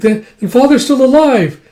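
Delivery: high, loud, stammered realization; acted excited hope. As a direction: burst out with sudden hope. Child learns father may live.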